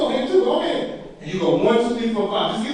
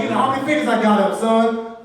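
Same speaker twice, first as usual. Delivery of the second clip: ordinary talk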